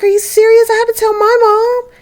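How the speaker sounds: put-on high, incredulous girlfriend voice; high-pitched acted objection; mock disbelief. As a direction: protest it way up high. Girlfriend balks at telling.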